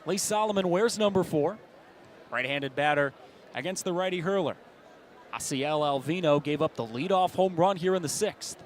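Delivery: ordinary talk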